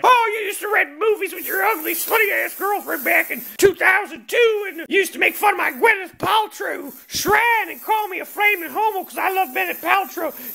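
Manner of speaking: high-pitched hammed-up rant; aggrieved Blockbuster guy. Imagined video clerk airing an old grudge, mock outrage. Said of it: "guy from Blockbuster will come out and be like".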